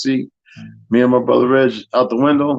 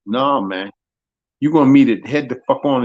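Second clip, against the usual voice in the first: ordinary talk, nothing marked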